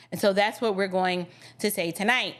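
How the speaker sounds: normal talking voice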